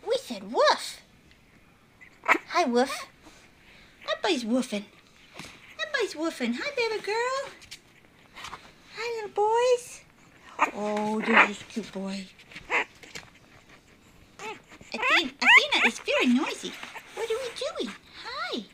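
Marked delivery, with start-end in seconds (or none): none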